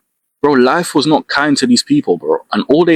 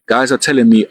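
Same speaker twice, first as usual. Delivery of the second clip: ordinary talk, nothing marked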